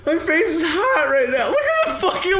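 laughing. Real, not acted. Flustered after teasing, laughing.

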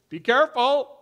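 mock caution. Imagined instruction, contrasted.

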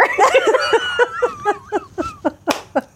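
long high real laugh; burst of laughter. Real, not acted. The speaker laughs at the boy's question about hair, real high laughter. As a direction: crack up for real.

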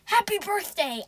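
loud, high irritated yell; exasperated shout, high and loud. A narrator's reading. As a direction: yell it to shut someone up. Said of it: "I yelled".

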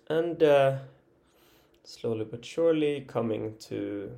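ordinary delivery

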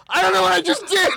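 high, helpless protest through laughter; laughing bewilderment. Retold or imitated. Comedian reliving his botched audition, laughing bewilderment. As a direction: wail it while cracking up.

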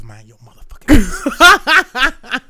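loud, high, drawn-out comeback; mock indignant retort. Granddad firing back at a child, loud mock indignation. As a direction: blast it out slowly, half laughing.

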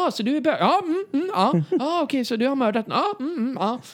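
high, mindless ja, mm; absent-minded agreeing. Retold or imitated. Imagined friends asking, high blank ja-mm answers. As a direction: agree to anything without hearing it. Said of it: "De kunde ju typ ha sagt".

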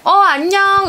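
light, lifted re-enactment of a hello; acted greeting. Wife re-enacting the greeting when husband came home, acted hello. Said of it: "이러고 왔어".

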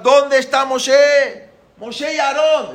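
high, drawn-out searching shout; calling out. Retold or imitated. Pharaoh searching for Moshe in the streets, shouting. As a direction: holler it down the street. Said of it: "Entonces empezó a gritar".

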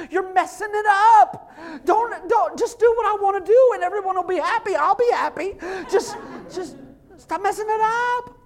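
high, loud whining meltdown; mock tantrum. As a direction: wail like a dad losing control. Acted tantrum at opinionated kids.

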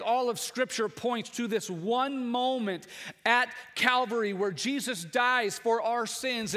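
building, measured preaching cadence. Acted. Pastor rousing the Easter congregation, rising preaching. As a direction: lift the congregation, stressing each why.

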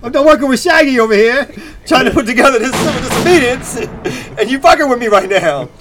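high-pitched exasperated protest, half laughing; mock indignation. Interrupted organizer retelling his reply to the stranger, mock indignant.

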